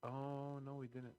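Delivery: ordinary talk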